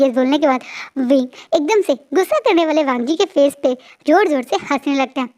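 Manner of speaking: ordinary talk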